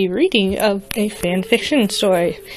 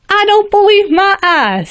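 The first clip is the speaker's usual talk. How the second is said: much higher, louder stunned farmer voice; Applejack; 11 semitones higher, 6 dB louder than usual. Acted. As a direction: gasp it out as a shocked country mare. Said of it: "said Applejack the farmer".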